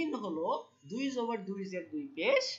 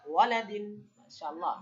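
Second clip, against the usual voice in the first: ordinary talk, nothing marked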